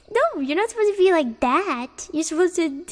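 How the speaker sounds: high, loud playful whine; mock indignant protest. Streamer scolds chat for staying calm, mock indignant. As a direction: object loudly in pretend outrage.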